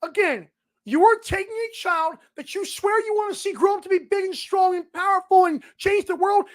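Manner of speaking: normal talking voice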